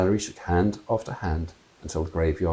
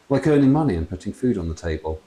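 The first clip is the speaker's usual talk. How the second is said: plain reading voice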